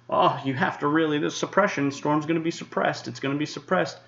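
high mocking whine; mocking whiny critics. Forecaster mocks critics who whined about storm suppression. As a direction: whine it like a know-it-all follower. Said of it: "crying their eyes out about suppression".

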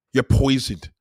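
loud, punchy accusation; shouted accusation. Acted. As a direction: yell it at the crowd.

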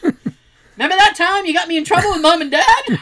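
laughing mock sibling jab; laughing taunt. Sibling taunting a sister who quit, laughing. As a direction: taunt a sister through giggles.

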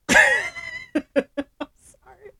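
real laughter breaking into sorry; giggling, can't stop; high laughing sorry; laughing apology. Real, not acted.

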